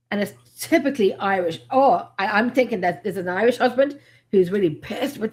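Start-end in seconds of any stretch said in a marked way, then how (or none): none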